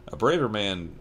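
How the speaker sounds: usual speaking voice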